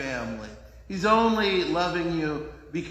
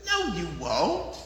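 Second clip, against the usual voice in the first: slow sly devil; slower than usual. Retold or imitated. Devil dismissing Eve.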